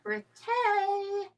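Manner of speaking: drawn-out, high-pitched birthday cheer; playful sing-song. Pretend. Co-host echoing the birthday pun, playful sing-song.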